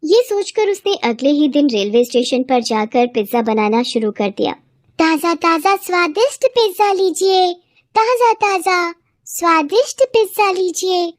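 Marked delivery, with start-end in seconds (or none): 5.0-11.1 high, drawn-out street vendor call; hawker calling out. Acted. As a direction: call out slowly to passers-by like a vendor. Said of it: "اس طرح آواز دے کر". (اونچی، کھینچی ہوئی پھیری والے کی پکار)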